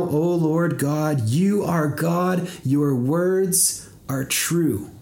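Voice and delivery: normal talking voice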